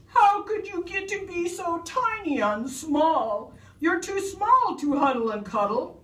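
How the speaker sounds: high storybook bawl. A narrator's reading. Bear sobbing to its shrunken teddy, bawling. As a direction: wail it high, hammed-up sobbing. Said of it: "hear the voice bawl".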